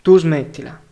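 loud, sharp maternal rebuke; snapping. A narrator's reading. Mother silencing her son's sudden suggestion, snapping angrily. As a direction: cut the child off with a loud snap. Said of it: "scattò sua madre".

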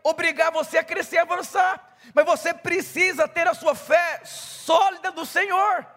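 loud, high fervent sermon delivery; full-force preaching. Acted. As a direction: preach it out to the crowd with fire.

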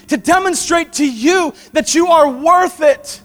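high, loud, slow proclaiming; full-force preaching. Acted. Preacher proclaiming worth to the congregation, fervent. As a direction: preach it over the room.

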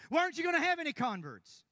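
loud, high, confrontational question; aggressive shout. Retold or imitated. The visitor confronting the preacher about converts, shouting. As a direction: bark the accusation so it startles the room. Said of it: "Sorry, I made you jump".

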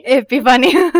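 loud relieved burst; relieved exclamation. Real, not acted. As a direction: blurt it out, finally remembered. Relieved at finding the word.

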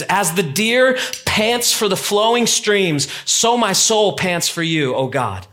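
slow, lifted reading of the psalm; slow scripture reading. Acted. Pastor reads the psalm aloud to the congregation, slow and lifted. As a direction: let each phrase of scripture breathe, slowly.

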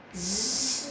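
long held soft buzz; long bee buzz. Shown as a demonstration. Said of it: "coba yang panjang".

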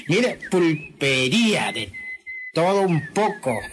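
slow, drawn-out reading aloud; proud sign reading. Acted. Painter showing the owner his new sign, proud reading. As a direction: read it out lingering on each word.

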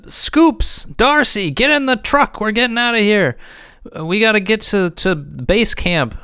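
high yelled orders over the roar; shouting orders. Acted. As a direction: shout to the crew to flee. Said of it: "he's shouting".

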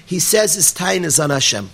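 normal talking voice

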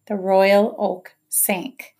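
usual speaking voice

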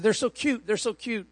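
put-on high, doting Israeli tour guide accent; imitating guide's accent. Tour guide gushes over conies, in his Israeli accent. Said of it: "he had this beautiful accent".

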